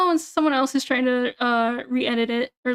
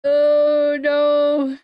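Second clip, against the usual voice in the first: loud oh no; 5 dB louder than usual. Real, not acted. Reacting loudly to chat.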